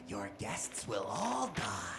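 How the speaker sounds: low, soft, slow ghost threat; a deep slow menacing murmur. Pretend. Playing ghost.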